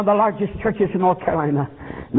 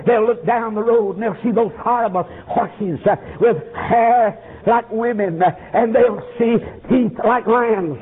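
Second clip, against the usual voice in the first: ordinary talk, nothing marked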